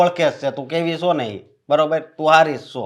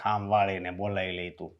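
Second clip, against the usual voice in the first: much softer, lower mocking echo; sarcastic repeat; 8 semitones lower, 15 dB quieter than usual. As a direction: throw her words back with disbelief. Mocking repeat of her promise. (ધીમા અવાજે કટાક્ષથી પડઘો)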